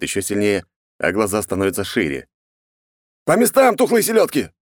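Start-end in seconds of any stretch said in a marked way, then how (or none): 3.2-4.5 loud, high-pitched shout at the crew; barked order. Acted. Грам rousing the frightened crew, barking. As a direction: bellow the command, voice pushed far up. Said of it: "гаркнул пришедший в себя Грам".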